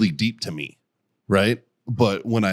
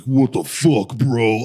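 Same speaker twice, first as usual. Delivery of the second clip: lightly voiced blunt bro; imitated unaffected bro voice; 3 dB louder, much slower than usual. Patient coming out of anesthesia, imitated bro voice. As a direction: play the dropped accent as a plain-dude outburst. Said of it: "you hear their real voice".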